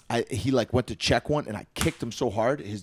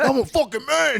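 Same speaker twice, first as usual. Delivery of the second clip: much higher, puffed-up tough guy; hammed-up tough guy boast; macho self-talk; 4 dB louder than usual. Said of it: "I'm like".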